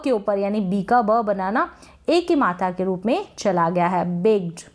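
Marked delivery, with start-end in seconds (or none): none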